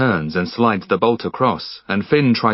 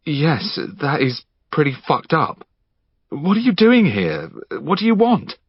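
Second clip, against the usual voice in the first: higher, wary voice; uneasy Finn; 7 semitones higher than usual. Acted. Finn questioning the intruder, wary. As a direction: keep him talking, cautious.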